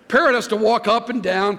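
ordinary talk, nothing marked

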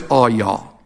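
all y'all with the L dropped from y'all; low, falling Southern all y'all. Shown as a demonstration. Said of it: "if it's more than two".

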